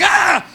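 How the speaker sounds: sudden loud, high scream of fright; startled scream. Acted. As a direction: scream as if jumped out at. Startled mourners at the funeral.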